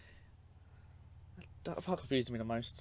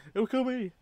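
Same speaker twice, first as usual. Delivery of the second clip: frightened cry; 11 semitones higher, 6 dB louder than usual. Retold or imitated. Voicing the terrified son.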